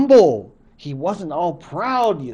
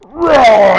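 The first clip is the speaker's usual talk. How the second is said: much louder roar like a bear; 7 semitones higher, 13 dB louder than usual. Acted. Giant bear smashing the fir trees, roaring loudly. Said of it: "And the bear goes".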